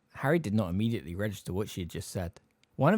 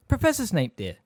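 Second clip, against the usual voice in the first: high-pitched gentle correction; motherly reproof; 8 semitones higher, 5 dB louder than usual. A narrator's reading. Mother corrects Harry about the teacher, reprovingly. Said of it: "said Missus Weasley reprovingly".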